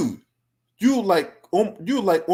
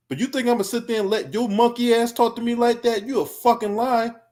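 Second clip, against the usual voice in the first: heated high-pitched retort; real indignation, raised in pitch; indignant; 7 semitones higher than usual. Real, not acted. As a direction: refuse the disrespect, voice pitched up. Angry retort at being talked down to.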